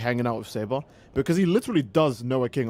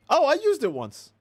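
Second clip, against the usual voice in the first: high, breezy cartoon hero voice; casual Sonic; 11 semitones higher than usual. Sonic telling Saber he used Excalibur, high and breezy. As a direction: toss it off like it's nothing.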